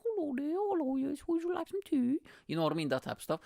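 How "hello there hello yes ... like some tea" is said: put-on very high, sing-song little old lady greeting; sweet British granny. Imitating grannies greeting newcomers on the bus, high and sweet. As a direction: mimic a twee British granny offering tea. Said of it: "little British grannies".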